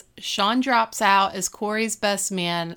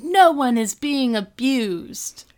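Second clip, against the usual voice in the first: menacing Topanga; 4 semitones higher, 5 dB louder, slower than usual. Retold or imitated. Topanga quoted mid-attack.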